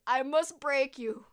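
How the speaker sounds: loud, slow mock-Russian threat; growing angry, imitate a Russian boxer slowly and loudly; forceful, drawn-out villain quote in Russian accent. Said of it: "And he gets all angry".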